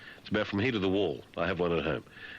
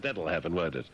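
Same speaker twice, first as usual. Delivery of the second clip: ordinary delivery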